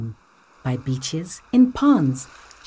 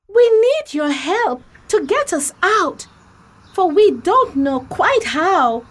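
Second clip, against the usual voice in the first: high, louder little straw voice begging for help; squeaky straw asking for rescue, urgent; Emmy the straw pleads with Kim to save her family; Emmy the straw pleading; 10 semitones higher, 5 dB louder than usual. Acted. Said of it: "the straw said to Kim".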